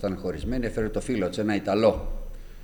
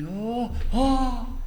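awed cry; 13 semitones higher than usual. Retold or imitated. Holy Spirit speaks through the Italian.

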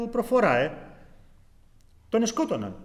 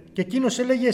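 usual speaking voice

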